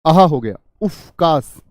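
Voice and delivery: ज़ोर से, धीमे खींचकर कराहना; दर्द में आह भरते हुए; दुख भरी आह. Shown as a demonstration. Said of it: "दुख में ही पैदा होगा". (दुख में ज़ोर से आह भरें)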